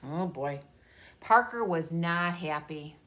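ordinary delivery

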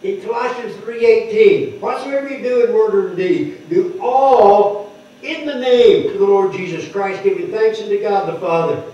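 a preacher driving home scripture; preaching. Acted. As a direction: preach it to the congregation with conviction. Preaching Colossians three.

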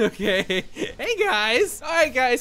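usual speaking voice

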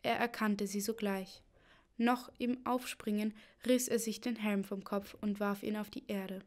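plain reading voice

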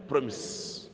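ordinary talk, nothing marked

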